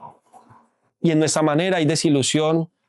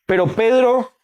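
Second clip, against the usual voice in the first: altar call preaching; 3 semitones lower than usual. Acted. Preacher urging the church to come back, fervent. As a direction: press the congregation to return to God.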